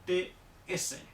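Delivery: "TS" juntas, como una africada; "TS" de golpe. Shown as a demonstration. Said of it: "suena como si dijéramos". (z china como "ts")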